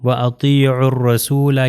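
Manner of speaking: وَأَطِيعُوا الرَّسُولَ as "wa atee'ur-rasoola"; وَأَطِيعُوا الرَّسُولَ carefully, waw, alif and lam silent; joined, silent letters. Shown as a demonstration. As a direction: recite وَأَطِيعُوا الرَّسُولَ linked with rolled double r. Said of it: "الف اور لام نہیں پڑھا جائے گا".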